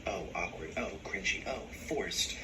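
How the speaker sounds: low, soft, dismissive one-word jabs; a hint of snide critics muttering; quiet mock-disdain for a shy guest; sneering commenters. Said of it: "imitating comment critics".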